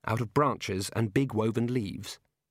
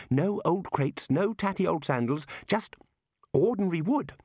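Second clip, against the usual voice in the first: put-on high, urgent young scholar's voice; earnest wizard Ponder; 7 semitones higher than usual. Ponder pointing out odd driftwood to the librarian, high and urgent. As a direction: press an unheeded point, pitched well up.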